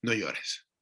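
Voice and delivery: soft, low comforting words; consoling. Retold or imitated. Elder comforting the weeping prophet, softly consoling. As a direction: gently soothe a weeping man. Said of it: "lo consuela".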